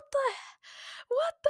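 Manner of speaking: high-pitched mock scream, not loud; retold scream. Podcaster reenacting her late-night shock at TikTok, mock scream. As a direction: squeal it in disbelief at normal volume. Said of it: "I screamed out loud".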